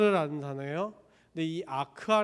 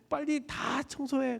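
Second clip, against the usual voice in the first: excited daughter; 8 semitones higher, 4 dB quieter, slower than usual. Acted. Daughter urging cleaning.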